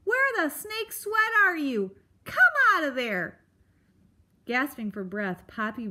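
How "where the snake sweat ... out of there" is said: high, loud porcupine yell; crying out. A narrator's reading. Porcupine ordering Poppy out of the log, shouting. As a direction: shout an exasperated demand. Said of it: "the porcupine cried".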